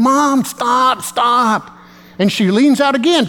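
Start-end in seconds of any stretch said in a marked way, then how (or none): 0.0-1.7 lightly voiced embarrassed daughter, higher pitch; mild imitation of a mortified kid; pleading daughter. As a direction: plead with mom to quit.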